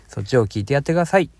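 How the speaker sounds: normal talking voice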